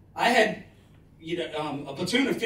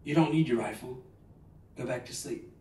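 put-on soothing girlfriend; 5 semitones lower, 4 dB quieter than usual. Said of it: "And my wife would say".

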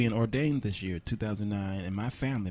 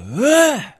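sudden high, loud yell; rancher's call; 12 dB louder than usual. Retold or imitated. Rancher calling his buffalo herd, high loud yell. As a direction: bark one sharp call across the field. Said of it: "And they all heard his voice".

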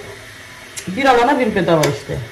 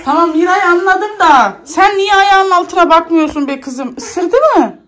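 much higher and louder, telling off the daughter; exasperated scolding; 11 semitones higher, 5 dB louder than usual. Real, not acted. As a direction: scold her sharply, fed up with the crying. Mother scolds her daughter over the crying.

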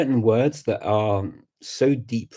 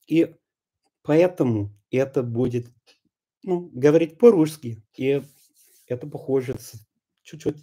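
Russian spoken in a put-on, higher caricature accent; exaggerated Russian accent; 4 semitones higher than usual. Shown as a demonstration. Coach moving from accented English into Russian, exaggerated Russian accent. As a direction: carry the put-on Russian accent over into Russian words. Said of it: "start using the Russian words over the accent".

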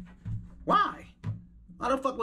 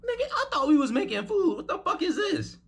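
shocked disbelief. Real, not acted. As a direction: pitch jumps way up in disbelief. Reacting in disbelief to weird cooking.

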